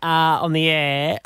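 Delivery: ordinary delivery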